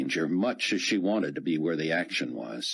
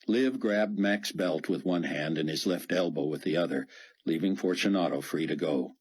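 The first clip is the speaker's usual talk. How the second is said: ordinary delivery, nothing marked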